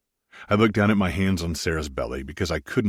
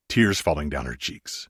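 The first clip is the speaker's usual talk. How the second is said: ordinary delivery, nothing marked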